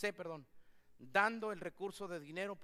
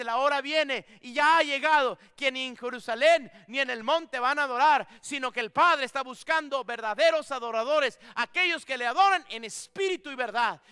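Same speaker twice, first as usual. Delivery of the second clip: much louder, higher proclamation; proclaiming the Lord's words; 5 semitones higher, 14 dB louder than usual. Acted. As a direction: declare it like the Lord's own word. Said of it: "El Señor nos dice". (proclamación fuerte y elevada)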